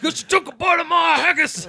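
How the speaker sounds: high mock yell of a drunk brawler; hammed-up drunk brawler shout. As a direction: ham up a pub fighter. Mocking drunk Irish brawler.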